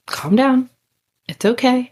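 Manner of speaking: high, bright soothing quote, pitched well up; calming her down. Retold or imitated. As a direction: talk her down gently.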